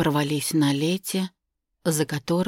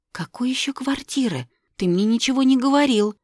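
shocked; 8 semitones higher, 4 dB louder than usual. Acted. Shocked heroine.

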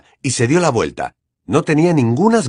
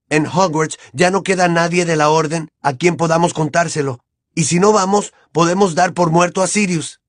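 urgent raised voice; 6 semitones higher than usual. Acted. Harry urging to rescue Sirius.

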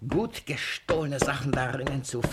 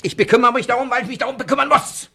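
much higher, far louder and fast; enraged shout; 11 semitones higher, 12 dB louder, much faster than usual. Acted. Master blowing up at his servant's insolence, enraged shouting. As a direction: explode at the servant.

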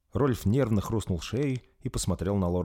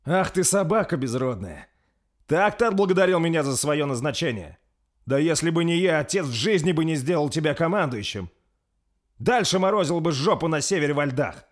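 higher, louder furious prince; heated outburst; 9 semitones higher, 6 dB louder than usual. A narrator's reading. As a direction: berate the traitor in a rage. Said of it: "Высказался сгоряча принц". (принц в ярости бранит лорда Свана)

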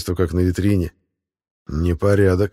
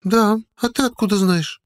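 put-on high girlish voice turning indignant; hard, suspicious girl; 11 semitones higher than usual. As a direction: challenge him as an affronted girl, pitched high. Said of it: "Голос становится жёстким, подозрительным". (жёстко, подозрительно, высоким девичьим голосом)

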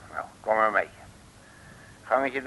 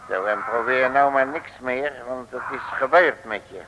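normal talking voice

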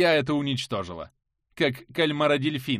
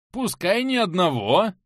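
loud slow exclamation; 6 semitones higher, 7 dB louder than usual. Acted. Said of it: "произнёс Лейте".

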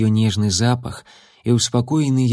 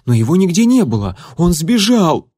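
fast, higher and louder in panic; panicked; 7 semitones higher, 5 dB louder than usual. Acted. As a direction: blurt it out in alarm. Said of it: "в ужасе".

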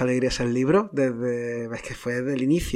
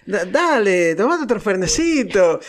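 higher, sing-song Argentine imitation; Argentine accent; 5 semitones higher, slower than usual. Guest retelling what Argentines told him, put-on Argentine accent. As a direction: imitate an Argentine urging another drink. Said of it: "como lo escuché, como lo viví".

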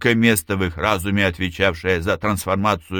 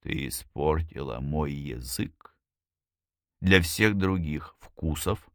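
soft, slow murmur; whispered; 4 semitones lower, 6 dB quieter, slower than usual. A narrator's reading. He whispers to her that she spoiled his taste, hushed. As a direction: murmur it low, taking your time. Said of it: "он прошептал".

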